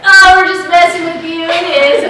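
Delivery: loud, high sing-song tease; teasing reveal. Retold or imitated. GM teasing the nervous candidate, loud sing-song. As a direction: sing it out like you got them good.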